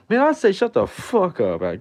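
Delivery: mock award speech turning into a yell; sudden shout. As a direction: blow up mid speech. Mock acceptance speech outburst.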